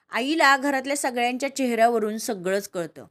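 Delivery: usual speaking voice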